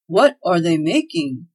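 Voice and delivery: slow call over the workshop din; shouting over noise. Acted. Claus asking above workshop noise, slow loud call. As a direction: make yourself heard above the hammering. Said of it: "shouted Claus above the noise".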